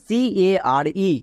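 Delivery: very slow, spelled letter by letter; spelling out letters. Shown as a demonstration. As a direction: spell C-A-R-E slowly for the class. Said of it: "আমরা জানি".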